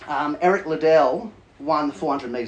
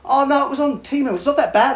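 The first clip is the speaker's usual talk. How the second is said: higher, louder comic comeback; mock defensive reply; 10 semitones higher, 6 dB louder than usual. Joker answers where the life goals came from, mock defensive. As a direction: brush off the doubt in a raised, lively voice.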